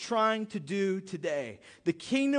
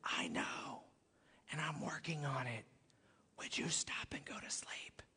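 hushed, soft whisper all the way through; 6 semitones lower, 12 dB quieter than usual. Pretend. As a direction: murmur it as God at your bedside. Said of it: "If he just came to you and said".

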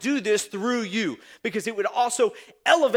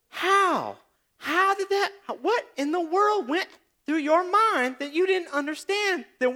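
exasperated disbelief; 7 semitones higher than usual. Acted. Exasperated rant at late friends.

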